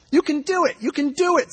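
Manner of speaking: high-pitched cheer from the crowd; encouraging shout. A narrator's reading. Fan to the nervous kicker, high encouraging shout. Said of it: "someone shouts".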